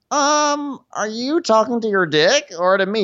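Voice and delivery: high-pitched put-on incredulity; mock indignation. Woman answering a man's "down", mock indignation. Retold woman snaps at man.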